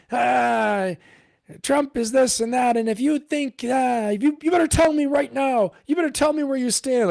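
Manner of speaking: high, loud, shouting mockery of an online attacker; mock angry troll. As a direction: ham up a shrill internet troll demanding answers. Mocking an Instagram attacker.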